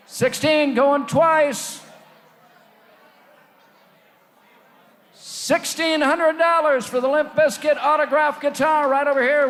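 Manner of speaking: auction bid calling; auctioneer. Acted. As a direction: call the bids like an auctioneer.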